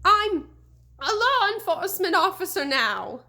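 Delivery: high, loud, quavering bluster; shouted, mustering courage. A narrator's reading. Scared deputy trying to threaten the ghost, high shaky shout. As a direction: puff up a frightened voice to sound brave. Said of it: "Crane shouted, trying to muster all his courage".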